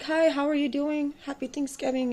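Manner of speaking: high, sugary greeting; sweet as pie on purpose; fake friendly.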